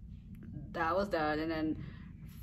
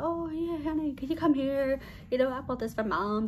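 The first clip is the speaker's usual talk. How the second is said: higher-pitched wifely call, easing down; innocent act; 7 semitones higher than usual. Retold or imitated. Wife calls her husband over for a prank, innocent act. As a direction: play innocent to set up a prank.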